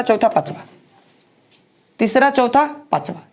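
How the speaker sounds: ordinary talk, nothing marked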